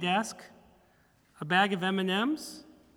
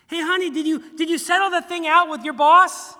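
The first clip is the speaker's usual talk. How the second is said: pushy wife asking; 8 semitones higher, 9 dB louder than usual. Retold or imitated. Wife asking husband, louder.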